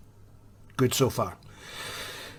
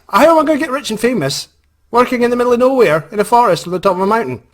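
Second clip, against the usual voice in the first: loud, high-pitched exasperated rant; worked-up protest; 11 semitones higher, 6 dB louder, faster than usual. Retold or imitated. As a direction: build a comic protest at full pitch. Worked-up rhetorical protest in retold thought.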